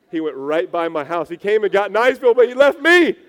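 rising panicked wail; mock panicked cry. Childhood fear of being left behind.

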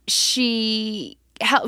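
usual speaking voice

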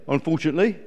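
high, loud indignant eagle; affronted eagle defending home; squawking. Acted. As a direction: insist crossly at full squawk. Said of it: "squawked Glen".

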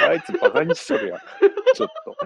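high-pitched, loud laughing protest; cracking up, voice shooting up; laughing retort. Real, not acted. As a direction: protest through helpless laughter. Laughing reaction to the joke.

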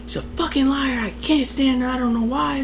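very high, strained but soft retold scream; mock kid's scream. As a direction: imitate an upset kid screaming, volume held back. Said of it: "I'm screaming, eleven-year-old screaming on the phone".

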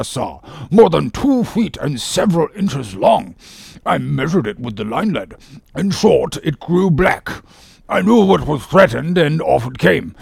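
measured, self-important medical account; imperturbable surgeon. Acted. Surgeon telling Ahab how the wound worsened, pompous. Surgeon resumes his case history.

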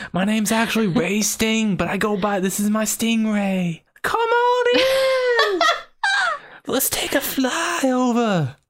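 high-pitched silly character voice; mock showman falsetto, leaping up on the invitation. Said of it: "What is this voice?".

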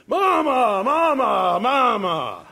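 high, drawn-out holler falling on each word; mock scream. Big Carl calls to his mama from the back seat, mock screaming. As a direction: bellow for your mother like an excited big man. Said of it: "Big Carl started screaming".

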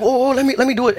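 high, urgent instructor grabbing control; panicky instructor. Retold or imitated. Driving instructor takes over the pedals, high panicky urging. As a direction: squeak it out like a nervous teacher. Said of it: "And she was like".

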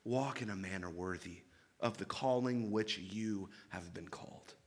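pleading. Acted. Preacher voicing Paul pleading with the church, soft and low. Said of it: "he's just pleading".

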